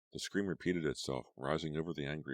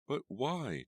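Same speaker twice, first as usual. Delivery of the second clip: crying out; 10 semitones higher than usual. A narrator's reading. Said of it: "Tracy cried".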